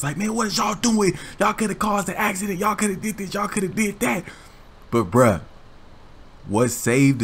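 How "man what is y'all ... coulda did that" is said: higher, lilting mock scolding; angry driver. Angry driver scolding the two kids, higher mock rant. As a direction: scold the kids sing-song. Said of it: "the dude is going crazy on us".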